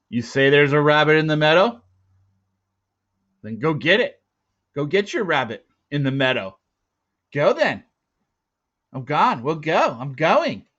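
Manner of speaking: loud, mocking taunt; sneering. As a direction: sneer at the boy and send him off. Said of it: "With a sneering grin".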